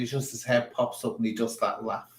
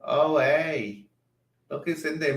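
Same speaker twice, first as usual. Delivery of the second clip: mock excited; 6 semitones higher, 8 dB louder, slower than usual. Real, not acted. Spots someone in the chat.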